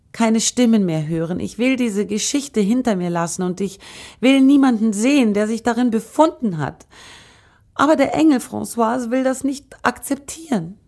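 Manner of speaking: impassioned plea, rising in intensity; agitated outburst. A narrator's reading. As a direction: let the refusal climb to a high, distressed pitch. Girl's anguished outburst against the angel.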